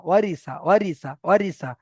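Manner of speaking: ওয়ারি সা in three short vowels; no stretch. Shown as a demonstration. Teacher drilling three short vowels, nothing stretched. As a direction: keep every vowel of ওয়ারি সা brief. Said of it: "এই তিনটেকে টান দেওয়া যাবে না".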